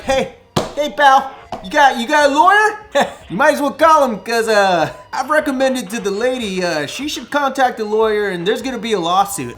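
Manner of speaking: mock the know-it-all cop; mocking cop impression. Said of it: "And the cop's like".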